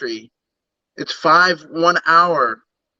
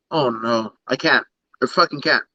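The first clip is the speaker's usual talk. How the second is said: ordinary talk, nothing marked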